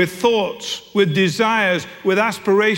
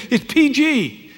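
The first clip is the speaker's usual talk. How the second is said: higher, slow spelled-out repeat; exasperated teenage daughter; 8 semitones higher, slower than usual. Retold or imitated. Daughter repeats the rating slowly to her father, higher. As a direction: spell it out for dad.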